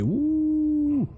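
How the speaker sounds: sustained high-pitched "woo"; celebratory drawn-out squeal; long high whoop. Real, not acted. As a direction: whoop it high and hold the note. Cheering a long weekend.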